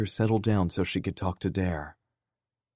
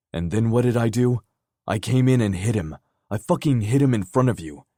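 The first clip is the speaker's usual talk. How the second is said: loud, higher, furious self-blame; angry shouting; 5 semitones higher, 6 dB louder than usual. Acted. Dare raging to Kenzie that he missed it, loud furious voice. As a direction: hammer each phrase with rage.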